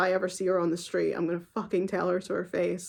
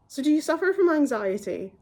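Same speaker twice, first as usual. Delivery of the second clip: higher, mock-sweet doctor asking; a light, high-pitched mock of the doctor; 8 semitones higher, 3 dB louder than usual. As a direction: imitate the doctor's chirpy question. Doctor quoted in angry story.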